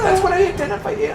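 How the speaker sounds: high, whiny hurt outrage; mock offended. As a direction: play up being deeply wounded. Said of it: "everyone's like".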